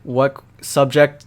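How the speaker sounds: ordinary talk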